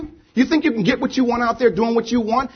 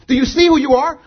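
God challenging; 7 semitones higher, 5 dB louder than usual. Acted. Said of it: "God says".

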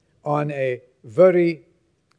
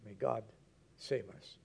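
very soft, low aside to God; hushed prayer; 7 semitones lower, 17 dB quieter than usual. Real, not acted. Preacher breaking off a martyr's death story to pray, hushed. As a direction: drop to a low murmured plea.

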